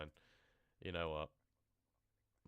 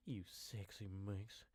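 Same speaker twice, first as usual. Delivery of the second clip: slow, sleazy come-on; leering invitation, drawn out; creepy flirt; slower than usual. Acted. Creepy hillbilly leering at the traveller, slow and sleazy.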